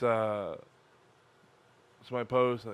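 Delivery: ordinary delivery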